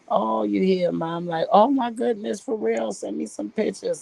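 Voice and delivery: high, delighted gush over the phone; excited phone greeting. Retold or imitated. Relative on phone hears about the baby, high delighted gush. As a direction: squeal with surprise and joy.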